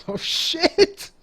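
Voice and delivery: loud, high, laughing outburst; whooping reaction to a burn; gleeful shock. Real, not acted. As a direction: burst out, delighted and scandalized. Reacting to a player's jab.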